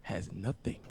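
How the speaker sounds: hushed, drawn-out words close to the mic; low, slow near-whisper with long pauses; slow and deliberate. Shown as a demonstration. Podcaster setting the chat straight, slow and deliberate. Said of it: "Let me say it slowly".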